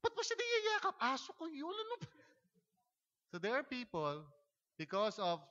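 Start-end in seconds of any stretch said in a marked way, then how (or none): 0.0-0.9 much higher shrill-pitched jealous demand; mock jealous accusation. Jealous partner confronting about a hug, high mock accusation. 1.0-2.1 mock annoyed reply.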